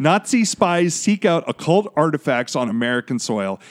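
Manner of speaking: loud dramatic newsreel read; 1940s announcer voice. Acted. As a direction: boom it like a wartime radio announcer. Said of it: "go back to my nineteen forties announcer voice".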